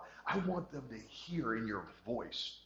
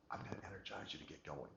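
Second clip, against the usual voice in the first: much softer, deflated delivery; low-energy wrong way; 4 semitones lower, 10 dB quieter than usual. Shown as a demonstration. Coach showing how not to motivate, much softer. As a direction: say it with no energy at all. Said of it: "Not by talking like this".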